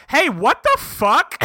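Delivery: stunned, drag it out; shocked outburst. Retold or imitated. Kaladin reacting to the shard blade trade, stunned.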